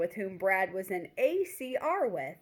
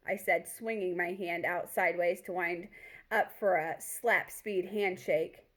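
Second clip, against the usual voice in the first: ordinary delivery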